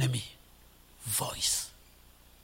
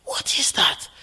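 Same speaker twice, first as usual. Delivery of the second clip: hushed whisper. Real, not acted. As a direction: whisper the question back, unvoiced.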